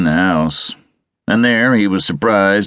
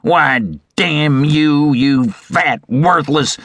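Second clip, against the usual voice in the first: slow, high, furious cursing; outraged creditor building an insult; thundering; 8 semitones higher, much slower than usual. A narrator's reading. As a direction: spit out the insult word by word. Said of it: "thundered Visconti".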